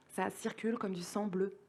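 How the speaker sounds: softly voiced, well below full volume; soft refrain. Poet softly reciting a circulation refrain to listeners, hushed but voiced.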